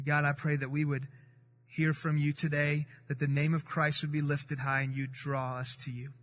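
soft, low closing prayer. Acted. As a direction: pray quietly and humbly to God. Opening prayer before sermon.

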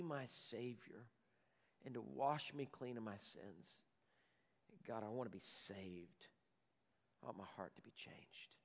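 low, very soft prayer of surrender; hushed prayer. Acted. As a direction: drop to a hushed, intimate plea to God. Models a sinner's prayer of surrender.

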